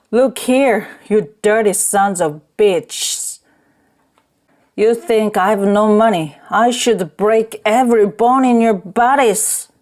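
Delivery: greater anger. A narrator's reading. Drunk man raging at the onlookers over money. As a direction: vent full fury at them. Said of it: "gave vent to an even greater anger".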